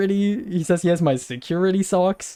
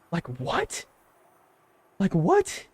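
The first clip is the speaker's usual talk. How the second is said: stunned; 5 dB quieter, slower than usual. Real, not acted. Stunned disbelief.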